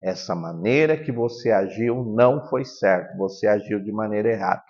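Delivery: low, softened voice of gentle correction; tranquil, firm reprimand; calm but firm. Shown as a demonstration. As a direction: correct a child quietly without wavering. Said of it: "fale com voz tranquila, porém firme".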